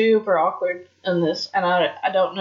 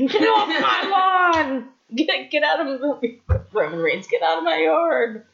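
put-on grumpy old man; 6 semitones higher than usual. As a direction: shoo kids off your lawn. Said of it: "like an old man trying to get kids out of his yard".

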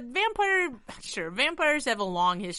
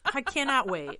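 usual speaking voice

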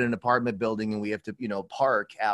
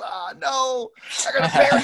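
high, loud mock shout, breaking into laughter; mock backing down; 11 semitones higher, 4 dB louder than usual. Acting out the bluff with the homeless guy, a high mock squeal. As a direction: squeak out the retreat in comic panic. Acted poker bluff exchange.

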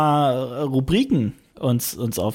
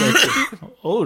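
coughing mid-sentence; spluttering after swallowing wrong; choking on a drink; 8 semitones higher than usual. Real, not acted. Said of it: "Geht's dir gut?".

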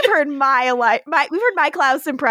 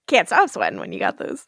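ordinary talk

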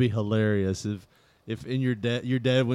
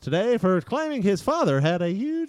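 formal news-report read; mock anchor announcement; 8 semitones higher than usual.

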